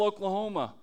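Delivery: ordinary talk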